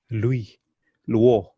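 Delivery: ordinary delivery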